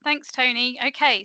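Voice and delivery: ordinary talk, nothing marked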